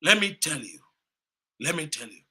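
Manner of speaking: usual speaking voice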